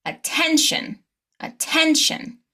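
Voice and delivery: "attention" with TEN high and stretched; primary stress. Shown as a demonstration. Teacher modelling for repetition, stress before the suffix. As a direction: lift the middle syllable of "attention". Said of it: "stress the syllable right before the shin".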